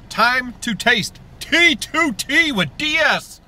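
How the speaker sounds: drawn-out show catchphrase, slow; slow catchphrase sign-off. Acted. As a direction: announce it like a show tagline. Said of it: "sign-off catchphrase".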